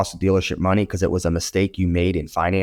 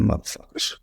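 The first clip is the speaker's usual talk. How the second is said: muttered curse; 4 dB quieter than usual. Retold or imitated. Muttered curse after firing.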